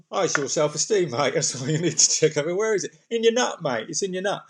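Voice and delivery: hammed-up Australian accent; mock Aussie bloke. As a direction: ham up the cheery Aussie mate. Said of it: "I'll put that accent on".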